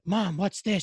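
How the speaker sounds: put-on high, loud kid calling to mom; imitating his child self. Six-year-old asking mom about the TV, imitated child voice. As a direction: pipe up like a startled child.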